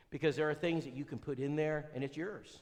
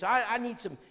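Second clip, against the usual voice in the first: higher, louder pleading woman; imitating a woman caller; caller's voice; 4 semitones higher, 6 dB louder than usual. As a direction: beg for the materials in her voice. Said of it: "She says".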